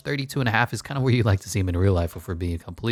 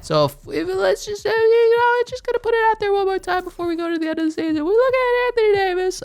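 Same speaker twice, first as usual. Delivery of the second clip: squeaky falsetto, way higher than usual; goofy cartoonish high-pitched talk; silly high voice; 4 dB louder than usual. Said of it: "I don't know why I'm talking like this".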